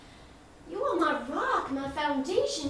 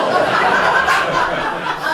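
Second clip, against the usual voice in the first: burst of laughter; 11 dB louder than usual. Real, not acted. Laughs at father's heckle.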